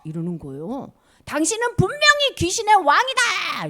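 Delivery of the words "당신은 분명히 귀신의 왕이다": 높고 크게 고발하듯 외치기; accusing shout. Acted. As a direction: shout the accusation, high and loud. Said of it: "이렇게". (높고 크게 외치는 고발)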